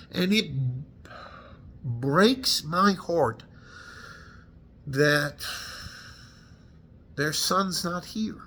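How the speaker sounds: very slow, halting, heartbroken; holding back tears. Real, not acted. Veteran grieving fallen men's families, slow and choked. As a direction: push each word out through grief. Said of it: "I'm trying not to get emotional".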